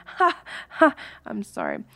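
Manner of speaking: usual speaking voice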